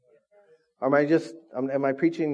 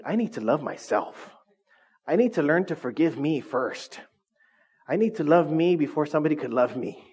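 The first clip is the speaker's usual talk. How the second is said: ordinary delivery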